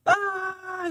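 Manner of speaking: high squealed mock scream; a short cartoon shriek. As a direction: kids fleeing the mascot, high mock scream. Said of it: "they start screaming".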